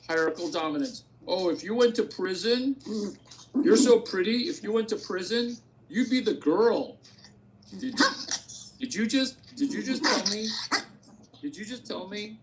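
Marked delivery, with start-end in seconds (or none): 1.3-7.0 mocking taunter. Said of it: "mocking imagined taunter". 7.8-12.4 mock flattered outrage.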